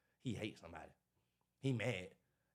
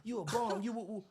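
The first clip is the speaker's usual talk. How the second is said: mock cussing out; 10 semitones higher than usual. Imitating someone mad at him, mock cussing out. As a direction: hurl a playful imitation of an insult.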